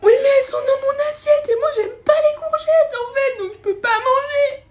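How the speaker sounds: very high tearful wailing protest; crying child. Acted. Child refusing courgettes to parent, crying and wailing. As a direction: sob out the complaint in a high child voice. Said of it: "Pourquoi tu pleures?".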